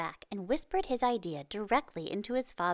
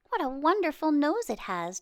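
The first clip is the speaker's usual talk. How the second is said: put-on bright, eager child's exclamation, higher and louder; excited; 7 semitones higher, 4 dB louder than usual. A narrator's reading. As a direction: marvel at it like a delighted boy. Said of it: "Chang said excitedly".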